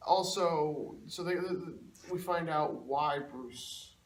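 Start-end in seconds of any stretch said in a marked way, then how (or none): none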